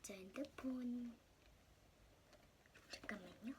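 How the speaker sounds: excited hushed whisper. Real, not acted. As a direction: whisper in awe of the toy. Excited whisper over toy phone.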